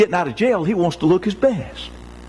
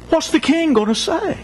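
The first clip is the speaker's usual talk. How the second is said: anguished cry; 9 semitones higher than usual. Acted. Servant despairs, repeats line.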